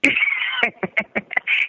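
shrill burst of laughter; high squealing laugh. Real, not acted. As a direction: squeal with delight. Laughs at mistress remark.

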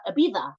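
Ibiza with a lisped z, "Ibitha"; Spanish lisp. Shown as a demonstration. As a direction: say Ibiza as "ee-BEE-tha". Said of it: "you say it like that with a lisp".

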